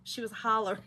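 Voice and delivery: ordinary talk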